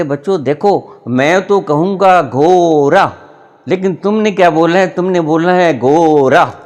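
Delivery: loud, high, insistent schoolmaster; teacher instructing pupils. Acted. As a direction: drill the class loudly, stretching the word to be copied. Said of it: "कहते". (बच्चों को ज़ोर से सिखाते master)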